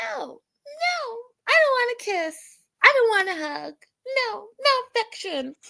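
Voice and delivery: very high whining man's voice; whiny high voice. Retold or imitated. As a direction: whine it like a petulant man. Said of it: "You sound outrageous".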